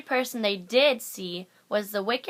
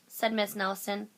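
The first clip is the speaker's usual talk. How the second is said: plain reading voice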